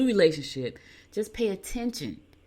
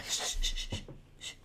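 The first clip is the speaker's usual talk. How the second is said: hushing shushes; 8 dB quieter than usual. Real, not acted. Grandmother quieting the baby who burst into the studio, hushing. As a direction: shush gently, several times over.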